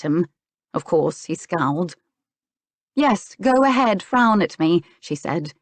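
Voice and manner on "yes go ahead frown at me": higher-pitched, goading retort; narrated exasperated jab; annoyed challenge. A narrator's reading. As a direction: dare him, fed up. Acted quarrel, she challenges his scowl.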